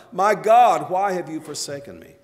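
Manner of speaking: loud, high outcry on the first words, then easing; anguished retold cry. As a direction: cry out to God, then let the volume fall. Voicing Jesus' cry from cross.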